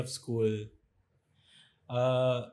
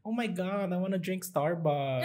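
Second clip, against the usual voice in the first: lightly higher mock conyo girl; mock Manila rich kid; 5 semitones higher than usual. Mimicking Manila classmates to podcast friends, higher mock voice. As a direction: tease the Manila conyo crowd, slightly higher. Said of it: "talya sa mga tapad yung mga".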